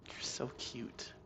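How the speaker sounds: slow whisper, cooing; whispered to the cat. Real, not acted. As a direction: breathe it softly at a pet.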